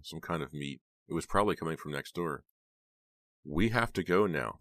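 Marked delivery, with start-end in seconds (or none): none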